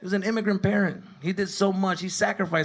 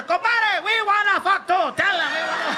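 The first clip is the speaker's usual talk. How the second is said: much higher, loud uncles joining in; rowdy uncles; 13 semitones higher, 5 dB louder than usual. Retold or imitated. Uncles backing up the dad, high and loud. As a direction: shout along like rowdy uncles. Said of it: "Even my uncles".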